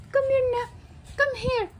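high, soft call to a pet; high coaxing call. Real, not acted. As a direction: coax gently, way up high.

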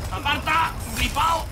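high, loud shout to back off; heated high-pitched yelling; alarmed shout. Real, not acted. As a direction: yell it at an attacker in your way. Fends off attacker in game.

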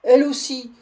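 loud, high cry of dismay; stunned cry. Acted. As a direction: cry out in shocked disbelief. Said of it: "cria monsieur Seguin stupéfait".

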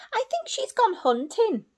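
high, eager little owl voice; Sarah the owlet. Acted. Reading to children, Sarah guesses where mother went, high. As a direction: voice the eldest owl brightly. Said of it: "said Sarah".

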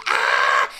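ordinary talk, nothing marked

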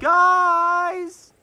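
loud, high, drawn-out yell; long, high-pitched shout; calling out. Acted. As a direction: holler for their attention. Calling out to partners from car.

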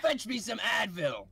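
high, pained plumber voice; sick Mario. Acted. Sick Mario asking his brother for pills, high whine. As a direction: whine for medicine. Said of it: "You sound like sick Mario".

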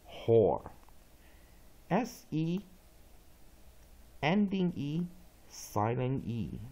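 slow, separated letter-by-letter phonics recitation; spelling drill. Shown as a demonstration. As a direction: recite each piece distinctly, pausing between chunks. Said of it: "所以再来一遍".

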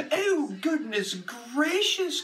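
high, slow dainty cry; princess voice. A narrator's reading. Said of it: "cried the princess".

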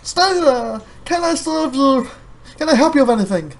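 high, eager, overbearing clerk voice; pushy shop assistant. Retold or imitated. Shop assistant hounding a customer, pushy and high. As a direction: chase the customer with a bright pushy offer.

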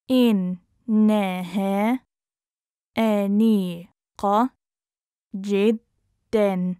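very slow, broken into separate syllables. Shown as a demonstration. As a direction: sound it out with long gaps. Teacher breaks phrase into syllables.

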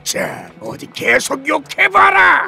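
loud, high, slow gloating; exultant shout. Acted. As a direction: relish the power, daring her on. Monster grows stronger from insults.

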